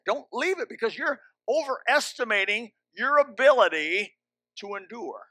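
normal talking voice

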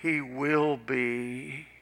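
very slow, drawn-out words; slow, weighty warning; ominous retort. Retold or imitated. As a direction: stretch each word out pointedly.

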